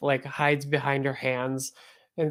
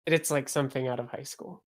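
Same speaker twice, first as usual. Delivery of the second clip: normal talking voice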